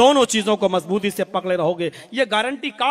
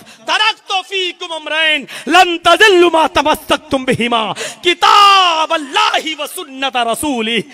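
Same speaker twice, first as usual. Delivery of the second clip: Arabic recitation; 7 semitones higher, 8 dB louder than usual. Acted. Preacher reciting the hadith in Arabic, chanted and loud. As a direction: intone the Prophet's words solemnly.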